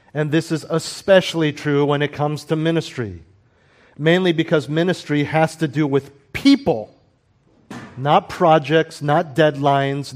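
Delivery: ordinary talk, nothing marked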